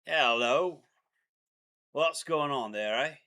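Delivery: put-on Aussie accent, friendly greeting; hamming up a laid-back Australian; mock Australian accent. Boyfriend taking an accent challenge, hamming up an Australian accent. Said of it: "one of the accents I enjoy doing".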